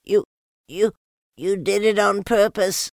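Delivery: high, loud stammering splutter. A narrator's reading. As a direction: sputter through soap. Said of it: "spluttered Piglet".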